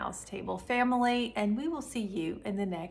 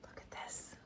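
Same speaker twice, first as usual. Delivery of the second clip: breathy hushed whisper; 20 dB quieter than usual. Real, not acted. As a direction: hush it like a secret. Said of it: "I feel like I have to whisper".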